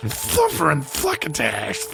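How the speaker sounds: Sylvester voice. Shown as a demonstration. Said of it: "Sylvester or whatever".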